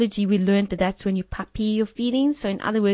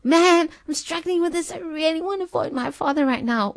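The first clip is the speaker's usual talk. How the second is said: higher and brighter-pitched, lightly acted openness; honest plea; 9 semitones higher than usual. Believer running honestly to Father, lightly acted plea. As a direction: blurt out your struggle openly. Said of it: "Let's say".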